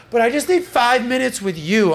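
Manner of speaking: put-on half-hearted whiny girlfriend voice; pleading girlfriend. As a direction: imitate a girlfriend begging for time. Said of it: "She's like".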